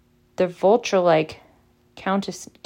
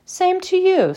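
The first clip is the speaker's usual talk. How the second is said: much higher, cheeky portrait voice; roguish; 13 semitones higher, 5 dB louder, slower than usual. A narrator's reading. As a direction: tease him back with a grin. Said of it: "said the fat lady with a roguish grin".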